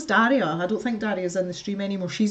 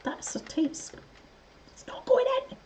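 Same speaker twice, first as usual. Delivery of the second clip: whispered frustration; 5 dB quieter than usual. Real, not acted. Struggling with a part.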